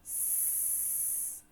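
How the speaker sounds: "S" as a long unvoiced hiss. Shown as a demonstration. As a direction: hiss the S softly. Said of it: "sweat begins with a".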